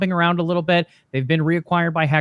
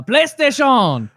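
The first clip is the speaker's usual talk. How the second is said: slow, high hammed-up Japanese accent; 8 semitones higher, 5 dB louder, much slower than usual. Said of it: "My Japanese accent".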